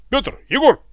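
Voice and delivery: high, loud, curt roll-call; short ringing bark. A narrator's reading. Guards report their names, short ringing bark. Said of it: "коротким звонким эхом рявкнули чернокожие стражи".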